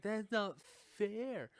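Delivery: mock protest. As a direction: protest it like an indignant student. Acting student protesting his expulsion.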